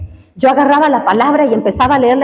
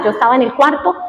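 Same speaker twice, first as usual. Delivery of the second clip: ordinary talk, nothing marked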